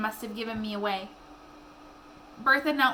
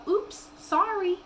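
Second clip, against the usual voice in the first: sheepish whisper; 7 semitones higher, slower than usual. Acted. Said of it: "slap her hand over her mouth and go".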